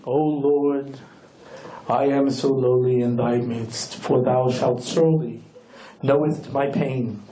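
low, slow, self-abasing supplicant's voice; a hammed-up lowly worshipper praying slowly; groveling pious prayer. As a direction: grovel before the Lord like a pitiful penitent. Mock pious prayer to God.

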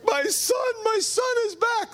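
high, overjoyed shout of a father; joyful father cry. Acted. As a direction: burst out with joy at his son's return. Vater begrüßt heimgekehrten Sohn.

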